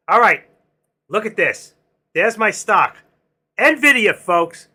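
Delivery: loud, high, punchy announcement; excited stock call. Real, not acted. Trader showing viewers his stock running, excited. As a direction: crow about your winning stock, voice jumping up.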